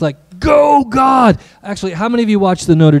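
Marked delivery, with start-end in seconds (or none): 0.4-1.4 high-pitched sports-fan cheer; big drawn-out rooting yell; cheering shout. Shown as a demonstration. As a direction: holler it like you're at a ball game. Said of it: "something like this".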